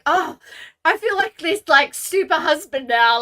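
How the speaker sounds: proud husband. Retold or imitated. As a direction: beam like a proud super husband. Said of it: "I was like".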